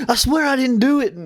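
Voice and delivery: high-pitched imitated denial; mock protest. Customer insists to the clerk who watched, mock protest. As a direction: imitate someone swearing innocence. Voices customer denying the deed.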